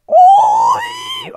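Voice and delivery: extremely high, loud screech; sudden piercing shout; ear-splitting yell. Pretend. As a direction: screech it to hurt the ears. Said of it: "Oh God, my ears".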